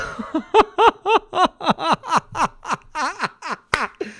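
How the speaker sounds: long, high-pitched helpless laughter; real laughing fit. Real, not acted. Streamer cracking up at a chat comment, long high laughing fit. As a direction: break into a sustained high cackling laugh.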